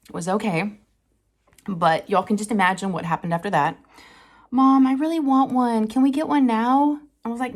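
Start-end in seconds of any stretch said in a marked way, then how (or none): 4.5-7.0 put-on higher, louder pleading child voice; imitating a child nagging mom; whiny begging kid. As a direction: beg like a kid who wants the bunny.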